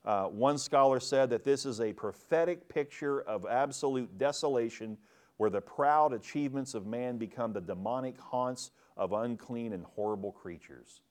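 ordinary delivery

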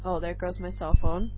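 ordinary talk

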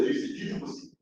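hushed among themselves. Acted. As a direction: mutter it so the others can't hear. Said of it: "talking among themselves".

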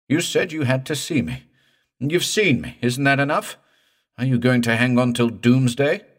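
high, loud exasperated pleading; desperate protest. A narrator's reading. Morse to the clinging dead man, high desperate protest. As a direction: demand frantically to be released.

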